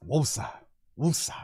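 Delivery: soft, low calming breaths of woosah. Real, not acted. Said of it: "hard for me to bring it down".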